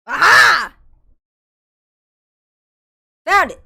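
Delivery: triumphant loud shout; aha discovery. Real, not acted. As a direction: shout it out, found it. Finding the lost file.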